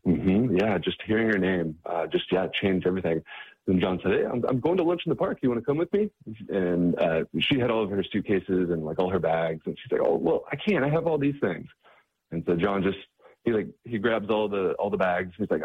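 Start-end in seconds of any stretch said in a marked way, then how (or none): 4.1-6.1 higher, upbeat friendly offer; cheery invitation. Retold or imitated. John inviting Rhonda to lunch, cheery and high. 10.0-11.7 higher, light apologetic refusal; mild protest. Retold or imitated. Rhonda declining because of her bags, light and higher.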